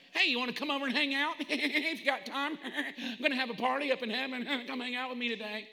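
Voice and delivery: high, chuckling, easygoing offer; mock a breezy, no-pressure invite; casual invitation. Preacher parodying an invite to heaven, laughing and casual. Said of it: "it's not even optional in the Greek".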